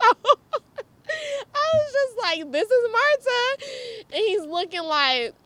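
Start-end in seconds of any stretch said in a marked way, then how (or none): 0.0-3.6 high, loud, slowed by laughter; helpless laughing retelling; laughing introduction. Real, not acted. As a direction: squeak out the introduction through laughter. Laughing awkward introduction to boyfriend.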